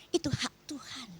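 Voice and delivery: whispered aside. Real, not acted. Aside on vengeance belonging to God.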